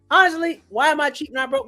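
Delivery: ordinary talk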